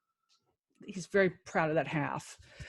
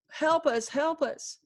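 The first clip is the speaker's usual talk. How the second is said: higher, pleading cry to God; retold frantic praying at the rest stop; desperate prayer; 7 semitones higher, slower than usual. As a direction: beg for help in panic. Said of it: "just praying".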